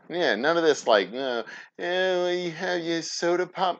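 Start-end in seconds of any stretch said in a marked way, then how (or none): 1.8-3.8 a higher, prissy sneer at soda pop; lightly voiced snooty competitor mocking the soda; sniffy mock rival, voice lifted high; mock rival vendor. Mocking rival vendors at the trade show.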